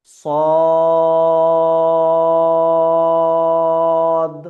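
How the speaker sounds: a loud, long, heavy সদ for students to copy; heavy Arabic Sad. Shown as a demonstration. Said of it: "এইভাবে একটা মোটা আওয়াজ বার হবে".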